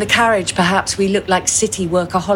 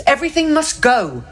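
higher sales call of a waiter; 10 semitones higher than usual. A narrator's reading. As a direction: announce the clearance like a waiter. Waiter calls out offer.

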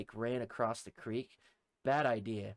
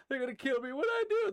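very high, loud squealed plea; acted panic; 5 dB louder than usual. Terrified man to the group helping him, acted high panic. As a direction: replay your bewildered terror.

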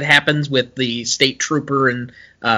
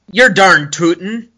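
slightly higher, louder Minnesota twang; Minnesota accent; 4 semitones higher, 4 dB louder than usual. Acted. Fan quotes a Fargo accent line, in Minnesota twang. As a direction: chirp it like a Minnesotan. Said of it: "let's call them the accent lines".

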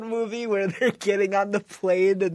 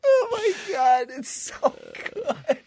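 laughing; 10 semitones higher, much slower than usual. Real, not acted. Laughing hard after the scene.

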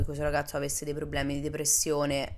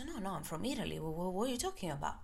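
timid denial; 3 semitones higher, 9 dB quieter than usual. Retold or imitated. She timidly denies being Russian.

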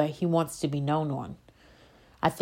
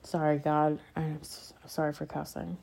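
much softer, sheepish apology to God; 3 semitones lower, 10 dB quieter than usual. Real, not acted. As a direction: quietly apologize for swearing. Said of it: "I hate to cuss on here".

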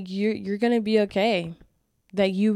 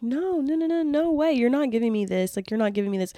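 higher, emphatic refusal; friend refusing; 5 semitones higher than usual. Retold or imitated. Friend refusing gas money, high and emphatic. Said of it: "She was like".

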